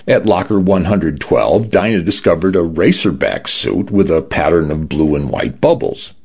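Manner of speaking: usual speaking voice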